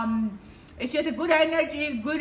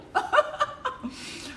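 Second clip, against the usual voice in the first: breathy giggle; 12 semitones higher than usual. Real, not acted. Laughing after blowing a kiss.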